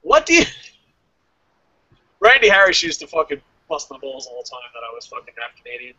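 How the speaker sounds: ordinary talk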